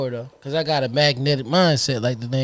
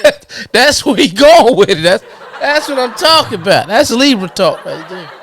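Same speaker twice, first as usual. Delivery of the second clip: laughing hard through the words; high, cracking laughter while talking; 7 semitones higher, 5 dB louder than usual. Real, not acted. Laughing hard at friend's joke.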